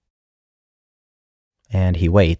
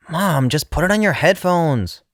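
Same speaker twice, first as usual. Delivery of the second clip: put-on much higher whiny teen; whining girl; 12 semitones higher than usual. A narrator's reading. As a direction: whine it from the back seat. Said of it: "one of the girls whines".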